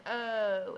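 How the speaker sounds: "Oh" stretched out as a long open O; long O sound. Shown as a demonstration. Teacher showing children the long O sound, drawn out. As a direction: draw out "Oh" as the long vowel. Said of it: "stretches it out and says".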